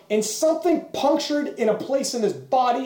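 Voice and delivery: ordinary talk, nothing marked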